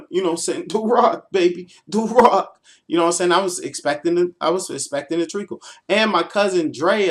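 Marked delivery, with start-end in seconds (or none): 0.7-2.6 wrestler hype. Retold or imitated. Hyping himself like a wrestler.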